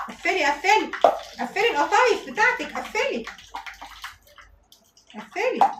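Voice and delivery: high-pitched repeated command; high, pressing instruction; insistent urging. Shown as a demonstration. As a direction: urge her on, pitch jumping up on each command. Urging viewer to close pastry quickly.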